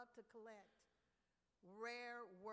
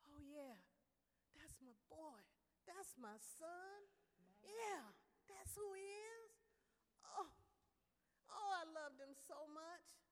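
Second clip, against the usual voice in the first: slowed, voice rising and breaking with feeling; a father choking up over his son's portrait; overcome with emotion; 4 semitones higher than usual. A narrator's reading. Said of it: "He almost lost himself".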